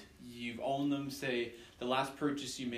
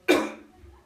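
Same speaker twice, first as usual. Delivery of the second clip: loud choking cough; 13 dB louder than usual. Real, not acted. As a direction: cough hard, something stuck. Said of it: "Sorry, I'm choking on something here".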